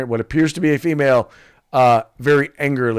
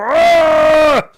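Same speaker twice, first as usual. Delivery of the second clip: sudden high "Ah!" gasp; high startled yelp; mock startled cry; 9 dB louder than usual. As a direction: yelp like you just got caught. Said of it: "stop and go".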